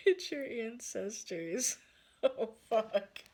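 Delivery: laughing realization. Real, not acted. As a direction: crack up as it dawns on you. Cracking up at the realization.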